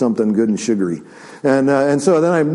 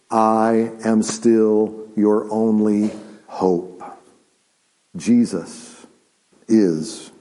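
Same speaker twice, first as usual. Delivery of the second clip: solemn preaching; 5 semitones lower, slower than usual. Acted. Preacher turns banana story to hope in Christ, solemn and measured. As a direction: let each phrase land gravely on its own.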